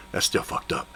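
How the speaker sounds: whispered insistence. Retold or imitated. Billy insisting quietly the shot was wrong, whispered. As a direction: hold your ground under the breath.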